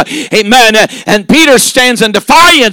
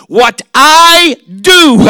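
slow, weighty voice of Jesus; Jesus' stern reply; 4 semitones higher, much slower than usual. Acted. Preacher voicing Jesus to Peter, slow and weighty. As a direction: draw out each word with authority.

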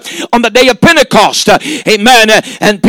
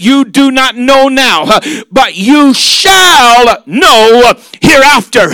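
prophetic shout; slower than usual. Acted. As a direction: stretch shall like a prophecy. Jesus' prophecy preached.

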